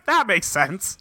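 dry, flat-faced acceptance with a laugh; mock deadpan. Recapping Gen's dying words to cohost, mock deadpan. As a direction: echo it as a mock-deadpan punchline. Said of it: "He literally says".

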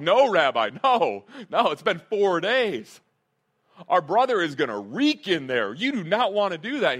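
loud, swooping objection; alarmed protest. Retold or imitated. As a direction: urgently plead with the rabbi to stop. Mourners object to opening the tomb.

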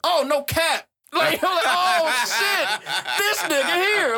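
high, slow, laughing disbelief; stunned disbelief. Retold or imitated. Retelling his reaction to the sample, high and laughing. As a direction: act floored by what you just heard. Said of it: "I was like".

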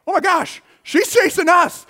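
high, loud panicked realization; sudden alarm. Retold or imitated. Boy realizing the lady chases them, high alarmed cry. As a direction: gasp it out in mock panic.

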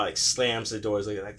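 ordinary talk, nothing marked

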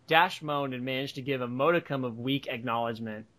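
plain reading voice

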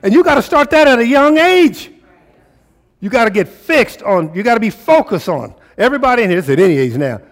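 high, loud, fervent sermon; rising preacher's fire; full-force preaching. Acted. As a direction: drive it home to the congregation.

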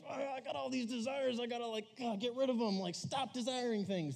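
high, far-off mocking whine; mock whiny desire voice. Youth pastor mocking a teen's whiny complaint about desire. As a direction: play the person fed up with wanting things. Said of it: "you're like".

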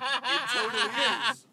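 lady's voice. Retold or imitated. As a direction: chirp it like an amused gallery lady. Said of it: "the lady came along and was like".

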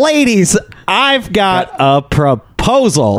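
slow, drawn-out showy proposal; hammed-up pitchman. Man pitching a scheme to strippers, hammed-up slow delivery. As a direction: draw out each word for grand effect.

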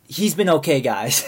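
imitated peacemaker appealing to the group; trying to placate. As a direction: talk them down, with a laugh after. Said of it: "trying to, like, calm the situation".

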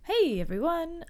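usual speaking voice

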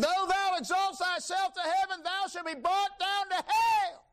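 high, soaring, slow preacher cry; high ringing pulpit proclamation; preaching it high. Acted. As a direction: lift the scripture up high and let it ring. Preaching scripture at full force.

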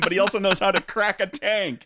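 ordinary delivery

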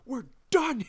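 high, strained mock yell; hammed-up furious demand; acted angry confrontation. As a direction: imitate an enraged man mid-chokehold. Skinner yelling during the chokehold.

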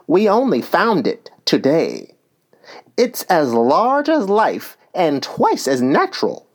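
eager. A narrator's reading. Hea eagerly introduces Alice to the unicorn, eager. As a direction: present it eagerly, pitch lifting. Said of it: "Hea replied eagerly".

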